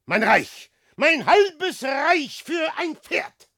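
loud, high, impassioned plea; loud theatrical exclamation; urgent cry. Acted. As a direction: cry it out as a grand offer. Desperate cry for a horse.

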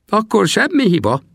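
put-on high, glad cry of an old trapper; joyful shout. Little Sam gladly calls out to his friend, high and joyful. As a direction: cry out in delight. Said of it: "kiáltotta a kis Sam örvendezve".

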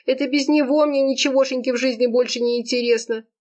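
high, plaintive lovelorn monologue; tearful outpouring. A narrator's reading. Serafima explains her love to her friend, tearful. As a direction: gush it out in a high, whining voice.

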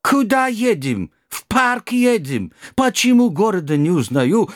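put-on startled old driver, broken Russian; old Kazakh driver. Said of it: "безуспешно вопрошал водитель".

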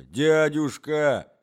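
протяжное громкое «дядюшка!» с мольбой; растяни «дядюшка!» умоляюще и громче; отчаянная мольба. Acted. Мольба племянника. (протяжное умоляющее «дядюшка!»)

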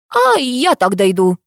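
slow, sulky hmph of a line; huffy, offended. A narrator's reading. Aunt turning down a lift home, offended. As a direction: drag it out with hurt pride. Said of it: "обиженно хмыкнула тётя Тамара".

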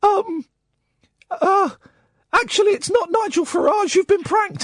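mock panic as the prank unravels; imitating a panicked caller. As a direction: prank caller losing his nerve on air, high and jittery. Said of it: "you could hear this guy panicking".

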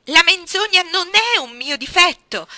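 urlo acuto, molto più alto e forte; grido stridulo. A narrator's reading. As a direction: grida con voce stridula. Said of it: "gridai con voce stridula". (Jane urla contro la zia, grido acuto e stridulo)

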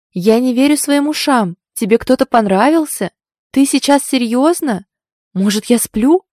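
loud, high, incredulous teasing; exaggerated disbelief. A narrator's reading. Friend ribbing the heroine about a crush, incredulous. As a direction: pile up astonished questions.

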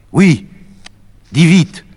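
very high, loud cry. Acted. Médée crying out to the messenger boy, very high and loud. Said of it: "Médée lui crie".

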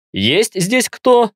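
slow, high-pitched call into emptiness. A narrator's reading. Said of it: "громко спросил я".

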